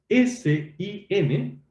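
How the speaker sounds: very slow, letters spaced apart; slow listing. Shown as a demonstration. Teacher to students, naming the phonemes, very slowly. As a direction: lay out each letter one by one. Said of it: "teacher names phonemes".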